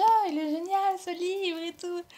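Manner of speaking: mock the cheerful saleswoman's enthusiasm; imitating the saleswoman. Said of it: "elle m'a dit". (imitation légère de la vendeuse enthousiaste)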